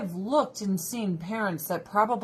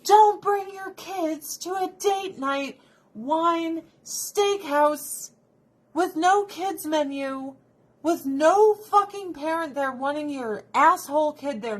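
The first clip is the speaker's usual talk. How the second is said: high, loud exasperated rant; 7 semitones higher, 4 dB louder than usual. Real, not acted. Ranting at inconsiderate parents.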